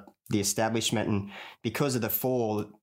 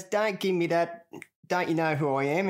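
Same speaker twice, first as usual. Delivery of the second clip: mock bouncer; 7 semitones higher than usual. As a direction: play the smug security guard. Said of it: "he goes".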